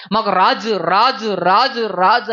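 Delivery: insistent chant of raju, raju, raju; people demanding a king. Retold or imitated. Preacher mimicking the people of Israel clamouring for a king, insistent. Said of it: "అన్నారండి వీళ్ళు".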